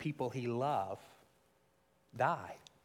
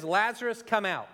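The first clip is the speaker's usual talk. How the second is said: higher, louder call into the tomb; commanding call; 7 semitones higher, 4 dB louder than usual. Retold or imitated. Jesus calling Lazarus from the tomb, a commanding call. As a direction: call him out with authority. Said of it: "Jesus says".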